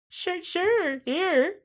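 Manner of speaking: timid. Retold or imitated. Frightened farmers answer.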